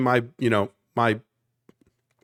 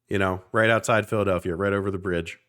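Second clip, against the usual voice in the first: ordinary talk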